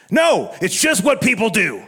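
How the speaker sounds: mock-indignant shouted refusal; almost angry. Helper refuses payment for fixing the trailer, almost angry. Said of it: "It's almost angry that I kept trying to pay him".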